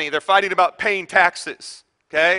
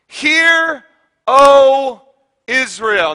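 proclaimed call; 5 semitones higher, 6 dB louder, much slower than usual. Acted. Solemn scripture proclamation.

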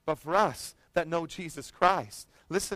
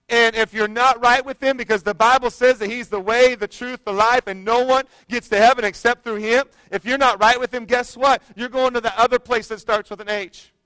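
higher and louder, driving sermon cadence; full-force preaching; 7 semitones higher, 10 dB louder than usual. Acted. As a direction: build the sermon to a loud, ringing call.

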